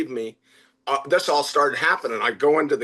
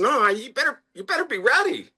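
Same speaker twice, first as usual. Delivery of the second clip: high, emphatic insistence; insistent warning; 11 semitones higher than usual. Retold or imitated. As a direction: press the staff to take it seriously. Warning staff about VIP visit.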